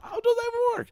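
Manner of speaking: a baffled squeak, very high; squeaky high voice. Retold or imitated. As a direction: shoot way up into falsetto. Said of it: "I'm like".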